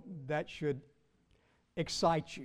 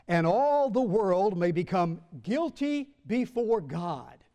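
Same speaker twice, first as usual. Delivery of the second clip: emphatic scripture reading; 3 semitones higher, 4 dB louder than usual. Acted. Preacher reading Romans to his congregation, deliberate and emphatic. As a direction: declare the verse firmly, phrase by phrase.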